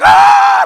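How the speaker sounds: high, loud imitated shriek; freaking-out scream. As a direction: scream like you've just walked in on a horror. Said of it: "She was, like, freaking out".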